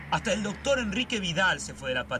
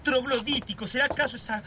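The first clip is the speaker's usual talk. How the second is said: much higher, feverish ranting; agitated; 8 semitones higher than usual. Acted. Brother raving to his sister about the old man, agitated. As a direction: spiral into a frantic conspiracy rant. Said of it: "Estás muy agitado".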